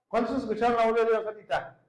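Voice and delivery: fervent preacher's rally; rousing question. Real, not acted. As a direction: stir the congregation up.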